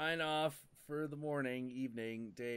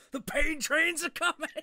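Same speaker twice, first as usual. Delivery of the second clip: hammed-up commercial linebacker, much higher and louder; Terry Tate; 13 semitones higher, 7 dB louder than usual. Linebacker from the office commercial announcing himself, loud mock bellow. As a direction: bellow it like a football enforcer in an ad. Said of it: "like fucking Terry Tate in that commercial".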